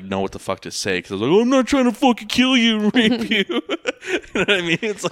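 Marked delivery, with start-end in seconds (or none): 1.3-3.6 high, loud, laughing protest; awkward laugh. Retold or imitated. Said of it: "I felt so fucking awkward". 3.7-5.1 laughing. Real, not acted. Laughing after awkward story.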